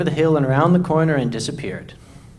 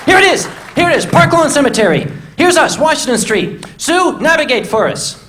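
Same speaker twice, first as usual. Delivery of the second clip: excited discovery; 12 semitones higher than usual. Retold or imitated. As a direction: burst out like you found treasure. Sudden discovery of the map.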